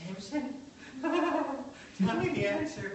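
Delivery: high, slow, giggly fake-tearful pleading; mock weepy plea. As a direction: ham up a wife turning on the waterworks. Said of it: "she turned on the waterworks".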